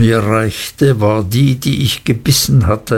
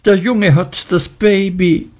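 higher, crying out in alarm; alarmed exclamation, pitched up; 8 semitones higher than usual. A narrator's reading. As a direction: call out in horror. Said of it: "rief Miss Murdstone".

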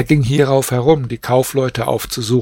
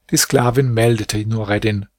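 ordinary delivery, nothing marked

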